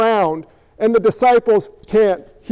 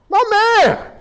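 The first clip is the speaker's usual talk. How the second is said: excited praise; 10 semitones higher than usual. Real, not acted. Praising a member who answered.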